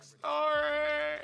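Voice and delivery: high, loud, silly sorry; a hammed-up squeaky apology; mock apology. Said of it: "and I'm like".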